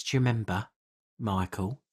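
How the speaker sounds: ordinary talk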